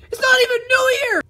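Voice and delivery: high, loud wailing protest; crying shout. Acted. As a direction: wail it in frustration. Quarrel ending.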